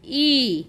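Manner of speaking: ई as a lone "ee" vowel; phonic I sound. Shown as a demonstration. Teacher showing the sound of I, ई as "ee". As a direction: sound out ई on its own. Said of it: "आयचा उच्चार होतो".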